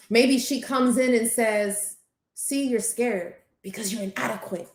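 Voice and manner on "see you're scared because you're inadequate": soft, sneering inner critic; a hint of a sly inner voice; saboteur voice. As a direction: whisper the doubt like a sly saboteur. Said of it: "That's a saboteur voice".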